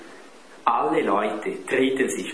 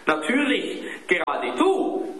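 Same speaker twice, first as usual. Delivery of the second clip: put-on high boy's outraged retort; indignant, high, stretched at the end; indignant cry. A narrator's reading. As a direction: accuse him in a high child's voice. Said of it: "schrie Klaus empört".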